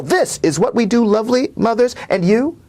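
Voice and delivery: rising, building poetry performance; poem reading. Acted. Poet reading his poem to close the interview, building and intense. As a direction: read the poem with growing intensity and lift.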